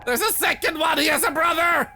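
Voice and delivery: high, loud panicked yells; panicked shouting. Real, not acted. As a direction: shout in real fright. Second entity chases him.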